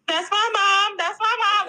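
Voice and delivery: high, loud proud cheer; imitated child cheering. Mother mimicking her child cheering at her graduation, high excited cheer. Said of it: "Like, she was cheering".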